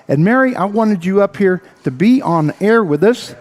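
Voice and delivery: ordinary talk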